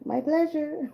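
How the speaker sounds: high, sweet mock-service voice; chirpy Chick-fil-A server. Reader jokingly thanks a viewer like a cashier, high chirp. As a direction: chirp it like a fast-food cashier. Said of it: "I sound like Chick-fil-A".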